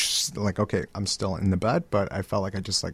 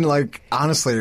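ordinary talk

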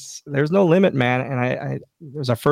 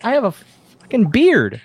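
slow, loud, higher outraged protest; indignant retort; 9 semitones higher, 5 dB louder than usual. Retold or imitated. Telling Finley he is no child, slow loud indignation. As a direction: protest loudly as if insulted.

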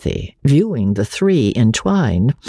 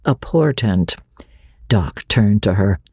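ordinary delivery, nothing marked

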